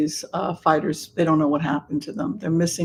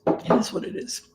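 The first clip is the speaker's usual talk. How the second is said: whispered aside; 6 semitones higher, 3 dB quieter than usual. Real, not acted. Resigned aside after allergies.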